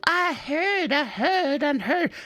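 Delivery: high mocking old-lady chatter; chattering old ladies. Old ladies gossip across the booths, high mocking chatter. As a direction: mimic overlapping gossip in a high voice. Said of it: "every old lady in there was screaming at everyone else".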